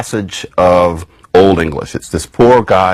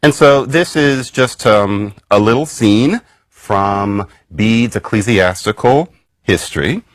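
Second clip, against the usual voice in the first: ordinary talk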